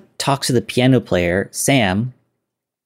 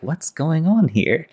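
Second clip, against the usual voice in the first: suspicious question; 8 semitones higher, slower than usual. Retold or imitated. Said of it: "and he's like".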